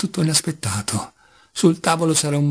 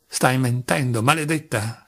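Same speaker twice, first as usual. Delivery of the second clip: ordinary delivery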